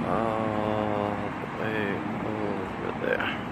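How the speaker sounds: very slow, stretched words trailing away; soft, lingering repeat; drawn out. Real, not acted. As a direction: draw every word right out, softly.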